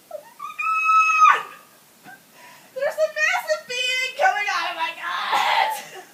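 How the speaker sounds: very high, loud, drawn-out shriek; screaming disbelief. Retold or imitated. Woman in labor to unbelieving doctor, screaming. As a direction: shriek it in mock agony.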